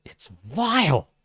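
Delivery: loud, high "Oh" then a slow, drawn-out "it's vile"; disgusted Garak. Retold or imitated. Garak tasting root beer, slow and disgusted. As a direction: recoil from the taste. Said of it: "He's like".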